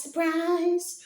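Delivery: high, loud jokey echo of surprise; playful high-pitched surprise; mock surprise. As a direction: squeak out surprise in mock astonishment.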